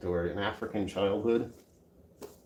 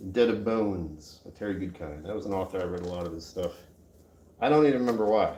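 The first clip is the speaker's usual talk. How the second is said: usual speaking voice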